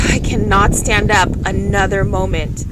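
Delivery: ordinary delivery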